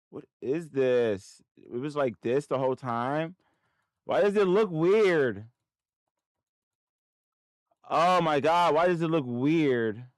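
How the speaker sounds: high, loud wail of disbelief; frustrated, high-pitched yelling; exasperated outburst. Real, not acted. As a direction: complain loudly at the broken screen. Exasperated at the glitching screen.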